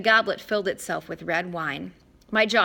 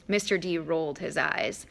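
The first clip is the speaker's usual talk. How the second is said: ordinary delivery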